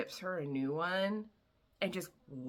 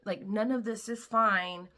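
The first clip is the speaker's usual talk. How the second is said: mock angry; 5 semitones higher, 5 dB louder than usual. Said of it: "he's getting mad".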